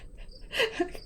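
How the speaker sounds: a quick breathy laugh; short laugh. Real, not acted. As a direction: laugh it off lightly. Laughs at the near sneeze.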